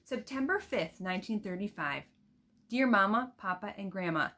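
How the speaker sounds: put-on expressive read-aloud of a girl's letter; reading aloud to children. Teacher reading a letter from the book to her students, expressive storybook voice.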